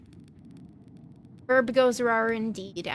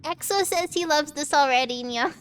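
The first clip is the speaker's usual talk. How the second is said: put-on cutesy baby talk; 3 semitones higher than usual. Shown as a demonstration. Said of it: "I'm starting Uwu voice".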